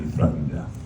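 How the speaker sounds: usual speaking voice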